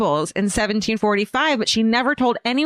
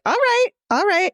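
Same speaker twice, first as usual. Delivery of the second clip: hammed-up uneasy agreement; bemused going along; 12 semitones higher than usual. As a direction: play along in a much higher voice.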